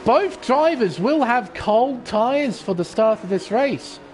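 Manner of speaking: usual speaking voice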